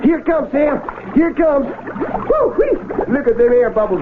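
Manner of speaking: high, eager cry as the catch rises; thrilled, straining to land it; excited. Acted. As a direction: fisherman shouting as the catch surfaces. Landing a huge fish.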